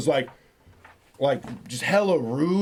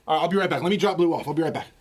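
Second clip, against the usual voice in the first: usual speaking voice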